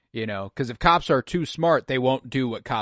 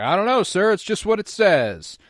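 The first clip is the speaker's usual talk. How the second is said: sheepish cadet; 7 semitones higher, 4 dB louder than usual. Retold or imitated. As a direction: play the caught cadet fumbling an excuse. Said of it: "and he's like".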